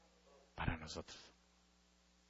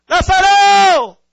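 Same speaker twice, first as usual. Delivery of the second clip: drawn-out, high, full-force shout; calling shout; 19 dB louder, much slower than usual. Retold or imitated. Jesus calls the dead Lazarus out, long loud shout. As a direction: holler the name long and loud. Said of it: "Y le grita".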